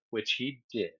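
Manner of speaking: normal talking voice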